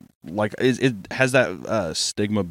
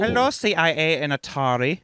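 higher, put-on German voice; mock German accent; 5 semitones higher than usual. Company man greeting CIA and Atari, mock German accent. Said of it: "Was that a good German accent?".

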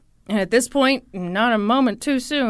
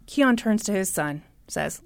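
usual speaking voice

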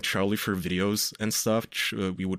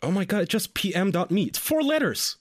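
high-pitched exasperation, pitch climbing at the end; exasperated; 9 semitones higher than usual. Retold or imitated. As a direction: spell it out in fed-up disbelief. Exasperated at explaining email to boomers.

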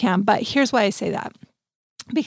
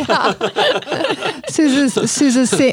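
laughing; 3 semitones higher, much slower than usual. Real, not acted. Still laughing at joke.

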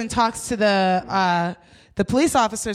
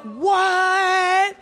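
high, stretched-out incredulous what; exaggerated disbelief; mock disbelief; 7 semitones higher than usual. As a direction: replay your shock, pitched way up. Acting out shared disbelief at driver.

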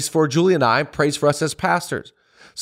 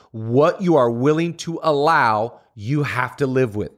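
ordinary talk

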